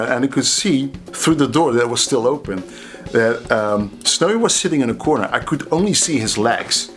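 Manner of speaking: ordinary delivery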